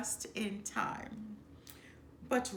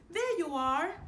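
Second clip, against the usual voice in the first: high call from the air, louder; calling from above; 9 semitones higher, 6 dB louder than usual. A narrator's reading. Zazu spotting the lost cubs, louder and high. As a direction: hail the cubs as he swoops in. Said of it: "Zazu called from above".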